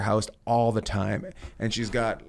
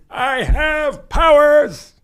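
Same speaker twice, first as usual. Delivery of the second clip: high, loud and drawn right out; hammed-up villain boast, very slow, much higher and louder; slow mock villain; 12 dB louder, much slower than usual. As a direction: stretch every word like a sinister wizard.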